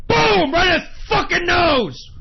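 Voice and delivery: roaring high and loud as if landing a punch; triumphant furious shout. Pretend. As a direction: scream it like you just broke his nose. Acting out punching Elon Musk.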